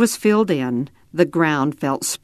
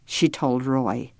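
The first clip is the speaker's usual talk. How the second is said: ordinary delivery, nothing marked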